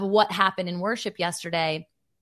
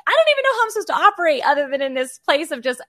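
higher, louder and fast, bubbling over; excited quote; 7 semitones higher, 6 dB louder, much faster than usual. Retold or imitated. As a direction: gush it out breathlessly in joy. Quoting her own overwhelmed thought.

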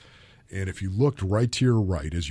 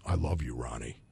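soft, low loving mother voice; tender; 8 semitones lower, 5 dB quieter than usual. Retold or imitated. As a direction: say it gently like a loving mom. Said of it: "she turns to me and says".

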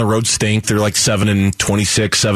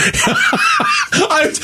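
burst of laughter. Real, not acted. Laughing at Nuggets fan.

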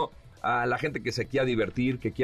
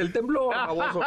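wife scolding; 11 semitones higher than usual. Retold or imitated. Wife correcting her husband's joke, mock exasperated and high. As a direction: snap it at him like an exasperated wife. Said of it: "Me dice".

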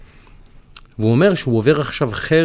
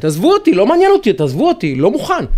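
high, raised outburst; acted exasperated refusal; 7 semitones higher, 4 dB louder than usual. Voicing a pressured friend who refuses to get involved, exasperated. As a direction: plead to be left alone, fed up.

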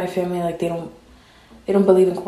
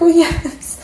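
much higher, louder, touched; 1 gushing yes; 9 semitones higher, 5 dB louder than usual. Retold or imitated. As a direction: melt and agree eagerly. Retold tender reply to brother.

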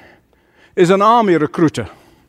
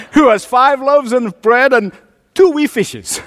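put-on higher-pitched comic Scottish accent; 6 semitones higher than usual. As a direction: finish the joke as a Scot. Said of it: "with a Scottish accent".

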